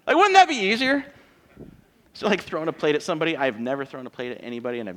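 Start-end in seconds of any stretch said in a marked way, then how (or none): 0.1-1.0 sarcastic. Acted. Mock suggestion of throwing plates.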